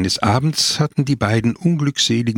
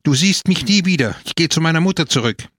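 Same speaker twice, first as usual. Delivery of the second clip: higher-pitched, indignant ultimatum; understated shrieking in a quarrel; mild screech; 6 semitones higher, faster than usual. A narrator's reading. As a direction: threaten to walk out, voice pitched up but not shouted. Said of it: "kreischte Gina".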